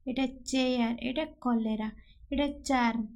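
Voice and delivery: ordinary talk, nothing marked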